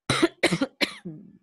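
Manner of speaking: loud, sudden real cough; coughing fit. Real, not acted. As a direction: cough hard in several bursts. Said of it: "I was coughing".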